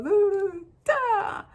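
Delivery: high, loud magician's "da-da"; showy "da-da" fanfare. Retold or imitated. Somebody arrives to hypnotize you, showy high fanfare. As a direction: sing out a mock magician's reveal. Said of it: "and they go".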